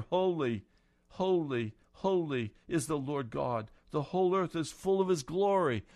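loud, high ringing proclamation of holy; proclaiming seraphs. Acted. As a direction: call it out like angels crying across the temple. Seraphs calling to one another.

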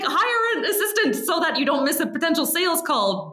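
high, laughing through the words; laughing quote. Retold or imitated. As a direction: quote him while cracking up. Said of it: "And Dan was like".

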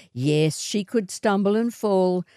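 ordinary delivery, nothing marked

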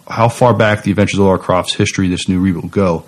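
ordinary talk

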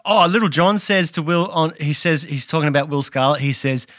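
usual speaking voice

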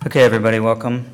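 loud, low announcer voice; host a con panel, booming; panel moderator voice. Acted.

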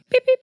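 very high squeaky beep-beep; mock beep sound effect. Shown as a demonstration. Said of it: "Here's the blooper sound effect".

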